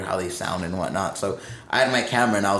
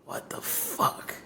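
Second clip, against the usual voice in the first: slow, soft, incredulous whisper; stunned whisper; 9 dB quieter, much slower than usual. Retold or imitated. Bandmate reacts to crew's fiery devotion, stunned whisper. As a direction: whisper it as if dumbstruck. Retelling own stunned reply to crew.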